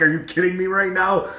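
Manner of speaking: high, loud disbelief; incredulous retort. Retold or imitated. Speaker answering his friend's denial, incredulous retort.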